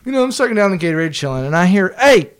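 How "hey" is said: high, loud call to get attention; imitated yell. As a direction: shout it like someone hollering across at you. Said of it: "Somebody yelling at me".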